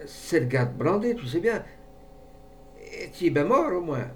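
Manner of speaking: high, frightened poacher; trembling voice. A narrator's reading. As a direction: ask nervously, pitch climbing. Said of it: "d'une voix qui tremblait".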